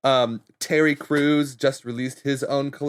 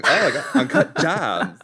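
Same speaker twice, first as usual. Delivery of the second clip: a drawled send-up of the phrase; mock socialite; 3 semitones higher, 4 dB louder than usual. TikTok users mocking the interview, drawled and laughing. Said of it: "it's just people going".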